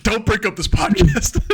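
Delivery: loud, high plea through laughter; laughing mock plea. Begging co-host Darnell not to split, laughing mock plea. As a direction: cry out half laughing, pretending alarm.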